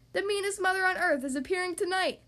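bellowed announcement. A narrator's reading. Little rabbit drumming up ticket sales, bellowing. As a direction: bellow it like a circus barker. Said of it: "Little Rabbit bellowed".

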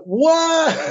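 squeaky high mock shriek of disbelief; high squeal of shock at the air date; shocked what. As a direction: yelp it like you can't believe your ears. Hears air date, retold shock.

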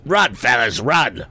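high frightened cry; scream. A narrator's reading. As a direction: scream at friends to flee. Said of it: "screamed Andy".

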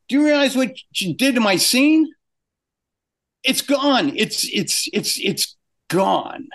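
high, distraught protest; retold outrage, high and breaking into stammered repeats; lost his cool. Animator answering boss about his erased scene, beside himself. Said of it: "because I've lost my cool".

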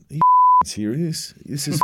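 mock the kid's complaint, half amused; incredulous scoff. Captain scoffs at young player.

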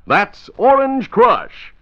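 Orange Crush drawn out slow, high and loud; chipper 1950s ad. Shown as a demonstration. Slogan stretched out.

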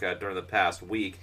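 ordinary talk

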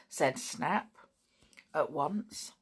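ordinary delivery, nothing marked

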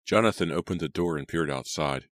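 ordinary delivery, nothing marked

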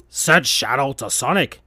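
plain reading voice

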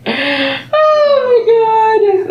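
high, loud, slow laughing wail; drawn-out shriek of laughter; crying laughing. Real, not acted. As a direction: lose it laughing over the comment. Overwhelmed by the comment.

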